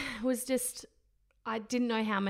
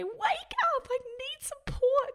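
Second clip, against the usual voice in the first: high, pleading call, a bit louder; mock urgent demand; 4 dB louder, slower than usual. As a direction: wake a sleeping husband, needy and high. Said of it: "And so I'd say".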